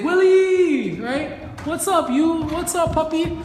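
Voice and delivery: excited dog greeting. Shown as a demonstration. Trainer hyping up Willie after a calm place, excited. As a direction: squeal a hello to the puppy. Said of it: "And then I can say".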